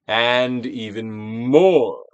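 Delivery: coaxing with a firmer, stretched voice; stronger prompt. Acted. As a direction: draw it out and press. Repeated releasing prompt.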